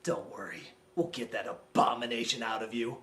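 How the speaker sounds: mock-soothing low voice; deep mock voice. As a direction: croon it like a patronizing husband. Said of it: "looks at Bella and says".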